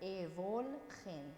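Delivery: evolchen drawn out slowly, V clear; slow sounding out. Shown as a demonstration. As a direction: stretch each sound of evolchen. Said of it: "because we have an O after it".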